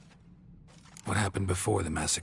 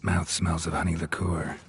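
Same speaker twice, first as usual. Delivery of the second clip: ordinary talk, nothing marked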